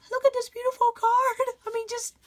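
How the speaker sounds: high, thrilled exclamation; showing off a card, high and delighted. Real, not acted.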